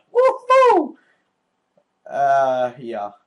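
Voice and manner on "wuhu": high squealed whoop; excited cheer. Real, not acted. Cheering at game start.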